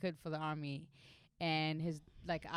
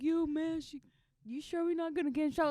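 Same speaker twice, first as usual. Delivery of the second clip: put-on higher, fast, nervous young soldier; worried soldier; 10 semitones higher than usual. Soldier asking the young commander, higher and nervous. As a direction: fret like a worried private.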